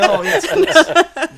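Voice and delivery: high, slow words caught in laughter; can barely speak for laughing. Real, not acted. Guest answering the host's joke, still laughing.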